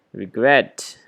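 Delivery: "Regret" with a loud re-GRET; second-syllable stress. Shown as a demonstration. Teacher shows where the stress falls, second-syllable stress. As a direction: punch GRET at the end of "Regret". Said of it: "so the stress right here".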